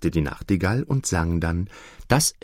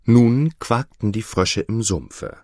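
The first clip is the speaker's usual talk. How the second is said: plain reading voice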